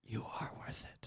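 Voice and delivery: whispered affirmation, ASMR style. Pretend. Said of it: "Are you doing A S M R a-, as-, affirmations?".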